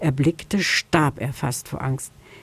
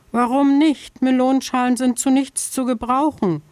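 higher, small pleading hare voice; pleading little hare; 7 semitones higher than usual. Acted. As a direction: beg timidly like a scared little hare. Hare protests.

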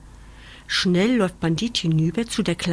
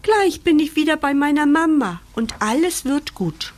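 high-pitched happy puppy; joyful; 8 semitones higher, 4 dB louder than usual. A narrator's reading. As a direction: rejoice in a raised, eager voice. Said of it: "freut sich der kleine Hund".

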